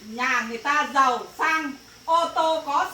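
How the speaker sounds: usual speaking voice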